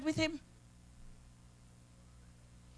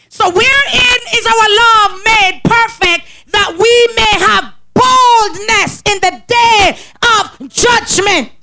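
slow, raised, shouted scripture; preaching; 6 semitones higher, 5 dB louder, much slower than usual. Acted. Preacher shouting the text about boldness on judgment day. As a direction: proclaim it from the pulpit.

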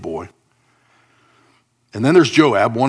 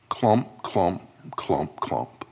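footsteps coming closer; 3 semitones lower, 5 dB quieter, much slower than usual. Acted. Lame man's footsteps approaching.

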